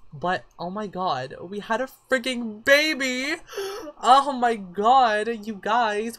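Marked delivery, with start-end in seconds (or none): 1.4-3.4 slow, high, loud excited exclamation; overjoyed. Real, not acted. Overjoyed at the baby's birth. 3.4-3.9 very high, sharp gasp; excited gasp. Real, not acted. Overjoyed at the baby's birth. 4.0-6.2 high, loud, drawn-out exclamation; overjoyed disbelief. Real, not acted. Overjoyed at the baby's birth.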